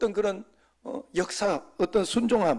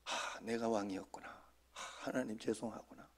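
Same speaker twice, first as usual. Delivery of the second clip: soft, lower, half-whispered realization; quiet contrite aside to God; hushed confession; 6 semitones lower, 15 dB quieter than usual. Retold or imitated. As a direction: murmur it to yourself as the truth dawns.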